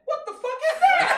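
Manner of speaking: very high, loud, laughing mock scream of disbelief; shocked yell. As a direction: shriek it like a stunned kid in the cinema. Kid in theater shocked.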